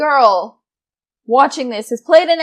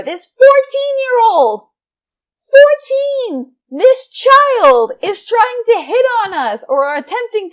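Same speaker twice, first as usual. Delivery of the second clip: much higher and louder, slowed, stressing every word in disbelief; incredulous outburst; 10 semitones higher, 6 dB louder, slower than usual. Real, not acted.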